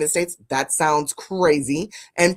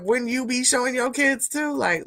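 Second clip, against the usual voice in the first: ordinary talk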